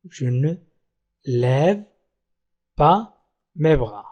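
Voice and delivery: low, soft "Je ne... lève... pas... mes bras" with long pauses; word by word. Shown as a demonstration. Teacher showing learners the negative sentence, word by word.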